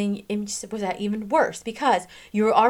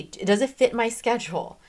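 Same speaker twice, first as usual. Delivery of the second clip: ordinary delivery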